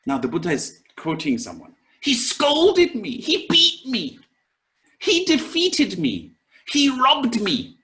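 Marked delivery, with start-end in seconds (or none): 2.0-7.8 pitched high, indignant list of wrongs; aggrieved complaint. Retold or imitated. Teacher voices someone listing grievances, high and indignant. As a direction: whine each grievance like it still stings. Quoting someone holding grudges.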